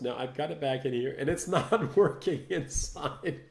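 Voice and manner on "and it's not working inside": laughing. Real, not acted. Laughing at the failing setup.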